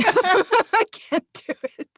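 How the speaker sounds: breaking up laughing, whispered and slow; giggling too hard to go on. Real, not acted. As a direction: reader giving up on the accent, laughing. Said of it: "I cannot do this".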